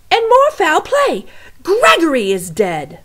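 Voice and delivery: very loud, high alarmed shout; shouting cook. Acted. Cook announcing Gregory's death, very loud shout. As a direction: bellow the bad news across the kitchen. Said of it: "shouted the cook".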